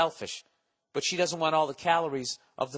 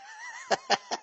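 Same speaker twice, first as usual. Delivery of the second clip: high squealing laugh. Real, not acted. Laughs at own impression.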